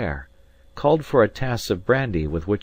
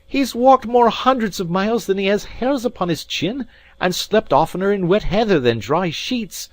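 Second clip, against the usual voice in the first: high, loud, indignant rant; furious tirade; 14 semitones higher, 6 dB louder than usual. A narrator's reading. Alan rages at the maid about his comrade's hardships, furious. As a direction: rail at the listener at high pitch. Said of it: "with a kind of fury".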